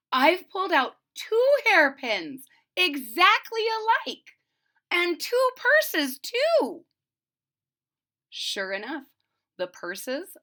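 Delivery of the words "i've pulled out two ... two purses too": much higher, louder, slowing at the end; astonished, almost squealing; amazed exclamation. A narrator's reading. Wife marvelling at the doubled hairpins, high and loud.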